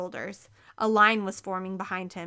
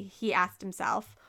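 ordinary delivery

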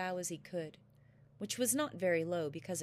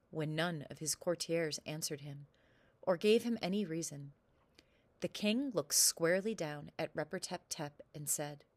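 usual speaking voice

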